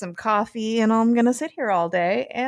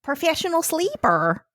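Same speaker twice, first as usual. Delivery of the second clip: high-pitched, put-on Minnesota accent; comic Minnesotan lilt; 8 semitones higher than usual. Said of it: "I really wanted to do the accent".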